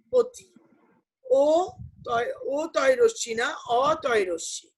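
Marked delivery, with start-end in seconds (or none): 0.1-0.5 অতি said as "oti", first vowel a rounded o; pronounced with o. Shown as a demonstration. Teacher showing a student how অতি is actually pronounced. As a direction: say অতি with an o sound, not a. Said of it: "অতি উচ্চারণ করছি ও এর মতন".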